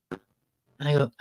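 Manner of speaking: ordinary talk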